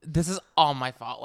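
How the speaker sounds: normal talking voice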